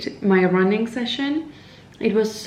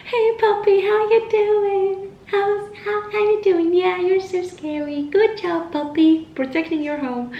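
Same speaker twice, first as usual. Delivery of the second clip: puppy-talk voice; 10 semitones higher than usual. Retold or imitated. Runner sweet-talks guard dogs to feel less scared, high puppy-talk. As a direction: sweet-talk a scary dog in a high singsong. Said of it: "talk to them. And I'm usually".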